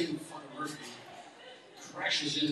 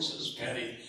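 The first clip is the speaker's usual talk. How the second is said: ordinary delivery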